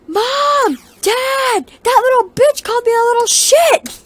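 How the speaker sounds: high, loud whining to the parents; whiny tattling. Retold or imitated. Narrator imitating the lady's kid yelling for Mom and Dad.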